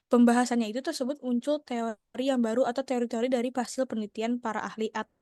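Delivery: ordinary delivery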